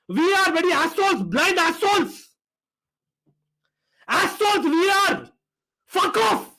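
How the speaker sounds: real angry cursing, pitch climbing to the end; exasperated, increasingly high-pitched swearing; worked up. Real, not acted. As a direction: curse the officials in rising frustration. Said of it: "I'm so worked up".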